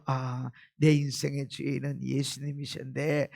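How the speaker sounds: low, soft, inward realisation; confession. Acted. Believer confessing before God who owns their life, confessing softly. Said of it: "나올 때마다 뭘 고백하는".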